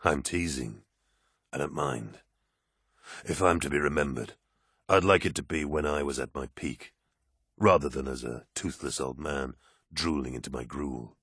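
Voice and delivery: low, teasing space marine; calm soldier, lightly amused; deep warrior voice. Acted. Loken's voice, lower.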